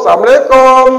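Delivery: high, loud visitor's call; calling out a greeting. Retold or imitated. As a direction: call out to the whole house. (seruan salam yang lantang)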